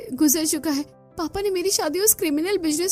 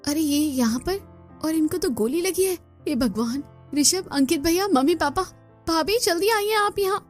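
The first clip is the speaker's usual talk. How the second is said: raised-pitch alarmed cry for help; घबराई पुकार; 5 semitones higher than usual. Acted. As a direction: call the family over in a panic. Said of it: "इतनी जोर से क्यों चिल्ला रही हो". (घबराकर परिवार को पुकारते हुए)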